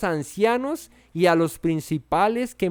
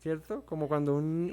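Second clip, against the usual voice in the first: usual speaking voice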